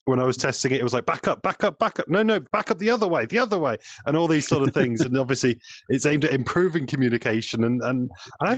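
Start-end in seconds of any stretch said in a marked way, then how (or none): 1.1-3.8 retold shouting. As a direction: imitate frantic directions to a driver. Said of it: "people do shout at each other".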